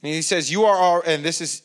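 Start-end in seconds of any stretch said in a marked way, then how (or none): 0.5-1.0 sarcastic. Retold or imitated. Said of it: "he's speaking sarcastically here".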